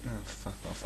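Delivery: ordinary talk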